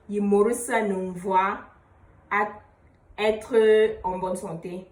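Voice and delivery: slow, word by word, me stressed; teacher spells out the reply slowly; slow demo phrase. Shown as a demonstration. Teacher models the healthy reply, slowly. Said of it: "si je suis en bonne santé, je vais dire".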